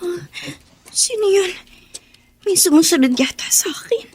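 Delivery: whispered, scared; frightened whisper. Acted. A girl alone at night thinks someone follows her, a scared whisper. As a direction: breathe it out so no one hears.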